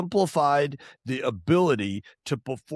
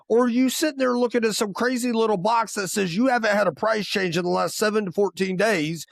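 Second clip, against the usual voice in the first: ordinary talk, nothing marked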